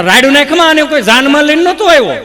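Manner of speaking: high, loud quarrelling comeback; angry husband. Acted. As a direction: shout back in an acted marital row. Husband retorts in mock quarrel. (ગુસ્સે પતિનો મોટો જવાબ)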